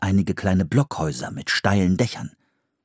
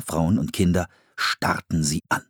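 ordinary delivery, nothing marked

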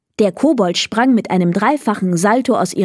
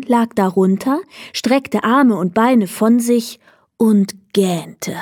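usual speaking voice

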